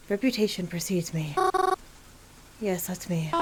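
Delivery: ordinary talk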